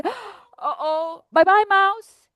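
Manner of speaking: alarmed fox. Acted. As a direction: start hushed, then bolt off high. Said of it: "the fox also sees him and he says".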